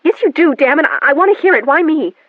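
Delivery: furious demand. A narrator's reading. Daughter furiously demanding answer.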